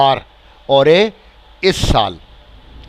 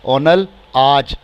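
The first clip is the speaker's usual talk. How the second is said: very slow model word; much slower than usual. Shown as a demonstration. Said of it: "teacher models word".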